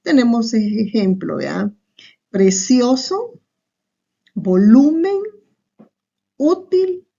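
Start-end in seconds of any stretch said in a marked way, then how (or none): 2.3-7.1 "Precioso, volumen, útil" spaced out, higher, leaning on CIO, LU, Ú; "Precioso, volumen, útil" as teaching examples, one word at a time with raised pitch; penultimate stress. Shown as a demonstration. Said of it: "cuando el acento se encuentra".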